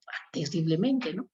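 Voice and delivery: ordinary talk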